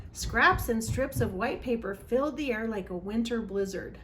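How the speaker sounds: ordinary delivery, nothing marked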